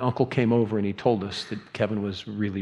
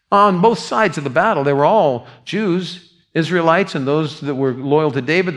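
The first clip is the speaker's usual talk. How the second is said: louder, driving pulpit voice; full-force preaching; 4 semitones higher, 6 dB louder than usual. Acted. Pastor to congregation, loud driving preaching.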